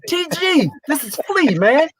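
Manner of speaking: high-pitched, delighted exclamation; retold excited recognition. Job applicant realising who the interviewer is, retold excitement. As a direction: burst out as if recognising an old friend.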